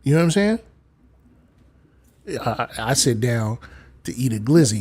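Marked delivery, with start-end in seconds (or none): none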